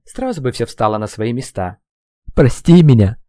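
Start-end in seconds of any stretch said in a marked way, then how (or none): 2.3-3.2 sobbing apology. Acted. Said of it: "шептал Слава, всхлипывая".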